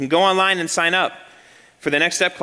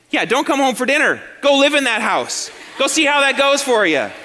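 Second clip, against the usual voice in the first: higher and louder, building to a shout; mock angry wife; 7 semitones higher, 3 dB louder than usual. Wife telling husband to live in the house, mock shouting. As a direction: play the fed-up wife telling him off. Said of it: "What do you think your wife's gonna say?".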